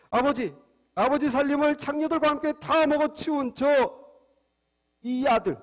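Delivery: put-on angry older son. Older son accusing his brother to father, mildly angry. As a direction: lightly act out resentment at a brother. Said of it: "화가 잔뜩 나서".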